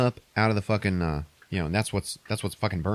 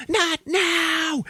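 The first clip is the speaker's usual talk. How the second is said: snapping as she cracks; a mock frantic shriek of not now; coming undone. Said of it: "Kate Hudson's like".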